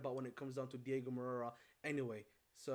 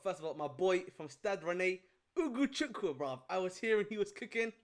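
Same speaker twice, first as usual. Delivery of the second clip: excited; 4 semitones higher, 7 dB louder than usual. Real, not acted. Hyping up a favourite player.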